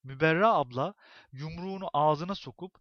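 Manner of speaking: plain reading voice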